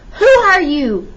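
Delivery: loud, high, drawn-out challenge; shouted demand. A narrator's reading. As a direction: shout it slowly at an intruder. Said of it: "Colonel Bowie shouted".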